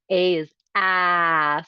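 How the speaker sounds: slow ah held out long; a long drawn ah; prolonged ah. Shown as a demonstration. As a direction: stretch the ah sound. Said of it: "something you can prolong".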